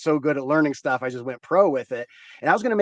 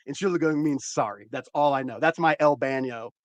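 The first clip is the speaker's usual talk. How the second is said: usual speaking voice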